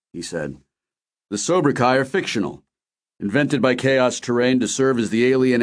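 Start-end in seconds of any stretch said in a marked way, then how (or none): none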